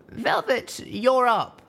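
high, loud call across the cabin. Acted. Littlepip calls Velvet Remedy up to speak, loud. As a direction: sing out her cue.

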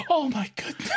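put-on a high, shocked old lady gasping it. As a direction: mimic a startled granny. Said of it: "and they're like".

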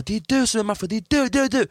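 high, half-shouted accusation; retold shouting. Contestants screaming who is Mafia, mock yell. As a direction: mimic contestants yelling accusations. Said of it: "skriker och håller på".

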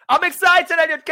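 shouted excited on request; best excited. Pretend. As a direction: explode with hammed-up excitement. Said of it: "Give me your best excited".

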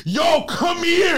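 mock Greek shout; calling out. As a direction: yell for the cooks in back. Greek restaurant staff calling cooks.

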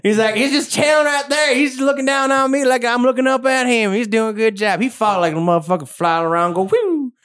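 a gleeful Southern shopkeeper bragging; Southern shopkeeper accent. Acted. I'm regretting the Southern accent thing.

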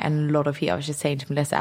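normal talking voice

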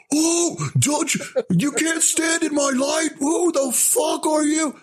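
high, slow, shouted mock star rant. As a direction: screech it like an outraged movie star. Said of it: "guy was standing in his light".

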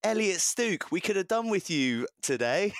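lightly voiced disappointed Bath fan. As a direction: hail him like a rueful fan. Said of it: "looks at him and goes".

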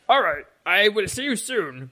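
high-pitched excitable farewell; hammed-up high stable-man voice; manic, grateful stable man. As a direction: bid them a gushing goodbye. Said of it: "And he goes".

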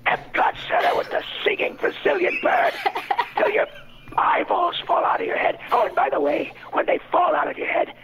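hissing, breathy voice of a cynical, arrogant demon who snaps into a shout; hateful demon. Acted. Improv prompt for a hateful demon, hissing contempt. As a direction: sneer every word like a demon who loves deceit. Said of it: "A, a, a hateful demon?".

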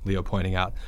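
plain reading voice

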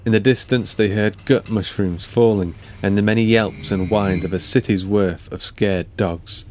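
ordinary delivery, nothing marked

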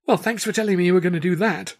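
high, loud grumbled complaint; grumbling. A narrator's reading. Assistant to her partner backstage, grumbling. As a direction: grumble it out, pitched well up. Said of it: "Tilly grumbled".

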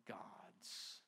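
whispered God's. Acted. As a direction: hush the word God's to a breath. Whispered emphasis in sermon.

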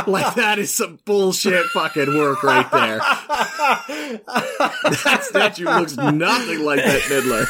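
laughing while ranting; half-laughing complaint. Real, not acted. Mocking the bad statue.

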